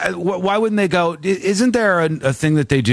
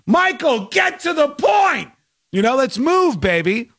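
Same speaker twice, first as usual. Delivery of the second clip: impatient shout; 11 semitones higher than usual. Real, not acted. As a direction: yell at the caller to get on with it.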